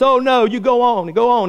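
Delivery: high, insistent brush-off; mock-stubborn old man; acted stubborn refusal. Old man refuses boat rescuer.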